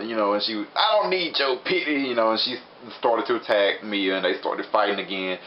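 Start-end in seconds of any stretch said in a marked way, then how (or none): 0.8-2.0 high-pitched, play-acted indignation; scornful refusal. Dayu rejects Mia's offer, mock indignant. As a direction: throw it back at her, pitch raised.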